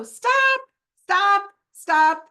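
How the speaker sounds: sharp high shouted stop, stop, stop. Shown as a demonstration. Speaker shows how she halts her inner critic, loud shout. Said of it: "I just go".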